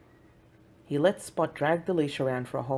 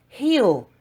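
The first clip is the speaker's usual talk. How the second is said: sharp command; 8 semitones higher, 10 dB louder than usual. Acted. Boy commanding pulling dog.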